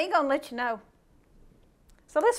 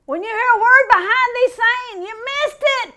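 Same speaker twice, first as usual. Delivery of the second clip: higher, louder warning voice; God's warning; 8 semitones higher, 8 dB louder than usual. Retold or imitated. The Lord warning a believer who went astray, loud. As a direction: call out a booming warning. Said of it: "I hope the Lord doesn't have to say".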